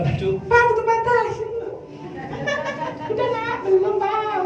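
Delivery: high-pitched imitation of wife and child; voiced family dialogue. Voicing family changing in one room.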